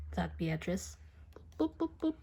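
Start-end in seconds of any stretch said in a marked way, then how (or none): 1.6-2.2 high playful boop sounds; bouncy boops. A narrator's reading.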